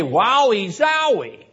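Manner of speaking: high, drawn-out mock wonder; mock amazement. Preacher imitating readers of commentaries, mock amazement. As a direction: imitate easily impressed readers, slowly. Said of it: "People say".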